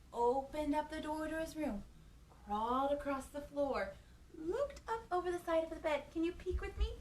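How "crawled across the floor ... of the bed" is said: normal talking voice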